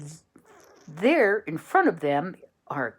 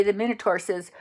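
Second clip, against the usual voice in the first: usual speaking voice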